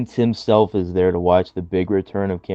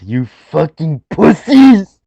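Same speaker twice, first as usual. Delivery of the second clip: mock furious rant; 12 semitones higher, 12 dB louder than usual. Cohost acting out a wrestler yelling at criers, hammed-up loud rant. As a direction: bellow it like a hammed-up angry wrestler. Acted Benoit rant quote.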